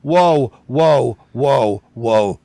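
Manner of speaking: loud, high repeated whoa, fading down; loud alarmed whoas. Real, not acted. Host halting the clip in alarm, loud rising whoas. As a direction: stop everything, whoa building then dropping. Reacting to the lip sync.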